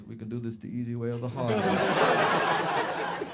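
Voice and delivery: slow, menacing Eastern European cop; Eastern European accent. Retold or imitated. Cop warns the protesting stag, Eastern European accent. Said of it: "this is my Eastern European accent".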